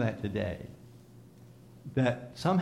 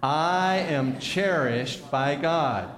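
very slow, emphatic declaration with conviction; like you believe it; 4 dB louder, much slower than usual. Shown as a demonstration. Preacher urging the congregation to believe it, slow heartfelt conviction. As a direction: declare it slowly, meaning every word. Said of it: "say it like you believe it".

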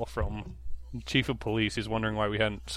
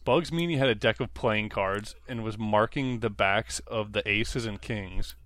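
normal talking voice

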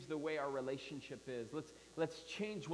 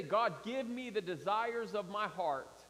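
emphatic mock prayer; 5 semitones higher, 6 dB louder than usual. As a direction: lean on it with disbelief. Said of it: "You can't say".

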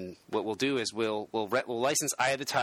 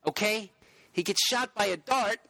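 put-on New York accent; 9 semitones higher than usual. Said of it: "you don't have to speak in a New York accent".

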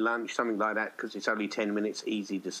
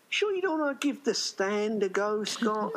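dad voiced much higher, coaxing; a high, eager fatherly nudge; imitating the dad; 12 semitones higher than usual. The dad urging his son to rent The Stand, high coaxing voice. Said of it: "And he'd be going".

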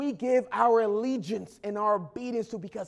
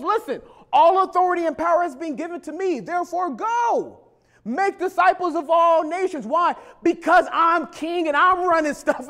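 loud, higher, fast commission; commanding Jesus; 7 semitones higher, 5 dB louder than usual. Retold or imitated. Risen Jesus commands disciples, loud and urgent. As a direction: send them out with authority. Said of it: "He goes".

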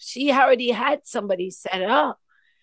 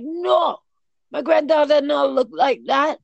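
Jamaican grandfather; 5 semitones higher, 4 dB louder than usual. Retold or imitated. Jamaican grandfather quoted in patois.

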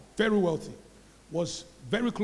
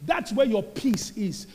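rising higher and louder line by line; building preaching climax; 6 semitones higher, 9 dB louder, slower than usual. Real, not acted. The pastor lifts the church toward the climax, ever louder. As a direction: build each "that's where" to a shout.

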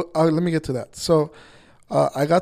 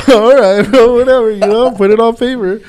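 laughing disbelief; 6 semitones higher, 13 dB louder than usual. Retold or imitated. Retelling his laughing reply.

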